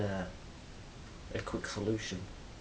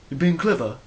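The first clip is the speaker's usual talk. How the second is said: loud, higher threatening challenge; aggressive challenge; 6 semitones higher, 10 dB louder than usual. Retold or imitated. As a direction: goad him, loud and hostile. Acted street confrontation, aggressor.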